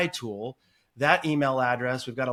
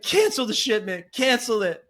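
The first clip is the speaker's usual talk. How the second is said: higher-pitched mock distress; imitated tearful customer; 8 semitones higher, 3 dB louder than usual. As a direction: plead like an upset caller, lightly hammed-up. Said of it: "she's in tears crying".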